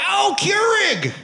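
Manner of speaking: long high holler easing into a loud rant. Acted. Redneck raging at Keurig.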